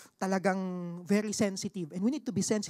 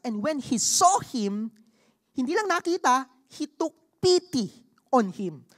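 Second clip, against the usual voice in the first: preaching at full force; 6 dB louder than usual. Acted. Preacher urging his congregation, loud and emphatic. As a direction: drive the point home to the church.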